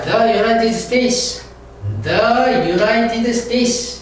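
"The United States" stretched out very slowly; slow classroom pronunciation of "The United States"; slow drill. Shown as a demonstration. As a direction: drag each syllable for learners. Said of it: "the를 ... 발음합니다".

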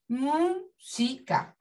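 "música" as mú, si, ca, with gaps; "música" broken into three separate beats; split into syllables. Shown as a demonstration. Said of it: "Al separarla en sílaba".

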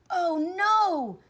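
high, loud cry of dismay; drawn-out, high storybook shout; alarmed shout. A narrator's reading. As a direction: shout out in horror. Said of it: "he shouted".

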